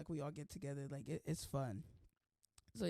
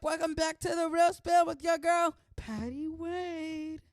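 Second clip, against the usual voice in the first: high, loud hyped-up host voice; goofy announcer intro; 7 semitones higher, 7 dB louder, slower than usual. Pretend. Vlogger opening her show in a hyped announcer voice. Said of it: "Don't ask me why I just did that".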